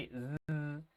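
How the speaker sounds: sustained buzzing Z sound; long voiced Z, like a buzz; vibrating Z. Shown as a demonstration. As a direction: hold the Z so the vibration shows. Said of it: "Tiene que existir esa vibración".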